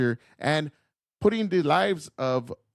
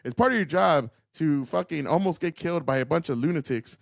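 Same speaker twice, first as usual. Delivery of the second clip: ordinary talk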